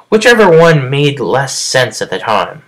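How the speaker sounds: ordinary talk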